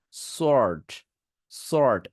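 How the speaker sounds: সোয়ার্ড said "sort", no w; drawn "sort" with falling tune; silent-w sword. Shown as a demonstration. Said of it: "এখানে ওয়ার্ড বলা দরকার নেই".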